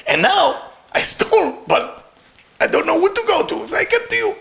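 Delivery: high, wailing plea to the master; a thief weeping on his rabbi's grave, pitch rising; crying plea. Acted. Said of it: "He lie on the grave and start crying".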